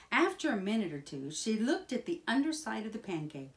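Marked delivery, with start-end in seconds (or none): none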